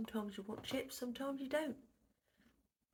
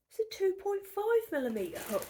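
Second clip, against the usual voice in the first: high, loud amazed discovery; stunned by the hook size; surprised; 10 semitones higher, 6 dB louder than usual. Real, not acted.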